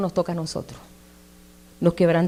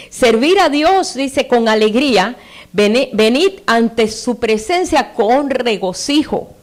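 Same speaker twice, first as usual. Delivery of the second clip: high, slow proclaiming read; scripture reading; 4 semitones higher, 4 dB louder, slower than usual. Acted. Preacher proclaiming the psalm to the church, high and slow. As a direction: lift the verses up to the congregation.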